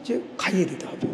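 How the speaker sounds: ordinary talk, nothing marked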